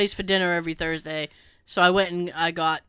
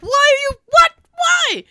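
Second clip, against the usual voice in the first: high, loud disbelieving yell; 7 dB louder than usual. Retold or imitated. Yelling at the TV over a show's twist. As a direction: shout in outrage at the screen. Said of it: "literally yelling at the T V".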